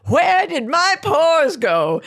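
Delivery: high, slow amazed gasp of joy; slow, high delighted astonishment; shocked delight. Retold or imitated. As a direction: marvel at it like a thrilled ad model.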